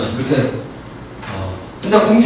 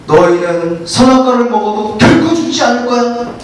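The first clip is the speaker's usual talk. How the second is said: higher, sly coaxing voice; tempting serpent; 4 semitones higher than usual. Acted. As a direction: lure her gently into the lie. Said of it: "하와에게 이르되". (뱀이 하와를 꾀듯 높고 교활한 목소리로)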